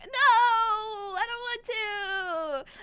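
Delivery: high, drawn-out mock whine; hammed-up reluctance, starting loud; whiny refusal. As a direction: wail it like a sulking child. Prince refuses, acted.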